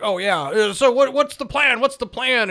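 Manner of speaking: hammed-up keen henchman; eager guard. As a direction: pitch it up like an overeager lackey. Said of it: "the guy's like".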